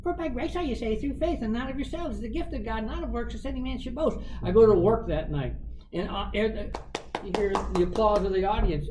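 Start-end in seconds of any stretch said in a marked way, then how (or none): 0.0-4.2 higher quoted voice; heard voice. Retold or imitated. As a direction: chant the verse up high. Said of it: "every beer says".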